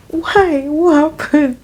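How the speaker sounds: high-pitched retold bewilderment; alarmed questioning. Teller's alarmed question to manager.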